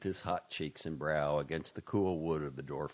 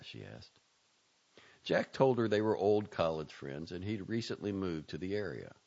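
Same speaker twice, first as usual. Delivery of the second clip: ordinary delivery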